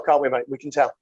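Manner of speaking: ordinary delivery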